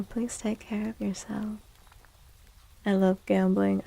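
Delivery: normal talking voice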